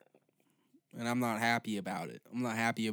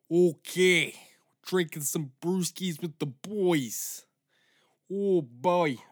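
higher, louder, slow beer-bro hype; 6 semitones higher, 8 dB louder, slower than usual. Acted. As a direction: shout it like a party bro. Loud bro voice continues.

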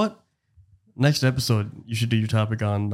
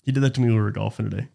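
normal talking voice

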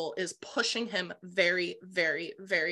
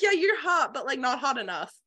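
mock dismissive; 6 semitones higher, 3 dB louder than usual. Mocking a backhanded compliment.

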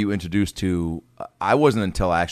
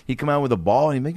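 ordinary talk

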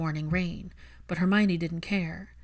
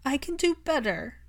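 put-on broken child voice; 8 semitones higher than usual. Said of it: "he sounded so broken".

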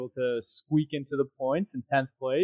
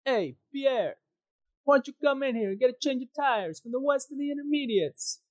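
much higher, hammed-up Italian team voice; paddock impersonation; 13 semitones higher than usual. Pit wall calling Pierre in for tyres, in a high mock Italian accent. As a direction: call the driver in like a cartoon Italian engineer. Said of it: "my Paddock impersonation".

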